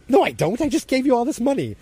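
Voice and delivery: high, loud protest in a retold haggle; mock indignant refusal. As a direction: push back hard, pitched right up. Acted refusal in haggle over money.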